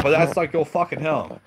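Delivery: high, hammed-up outrage; mock raging protest. [name] protests about the toy, mock raging. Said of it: "He starts raging".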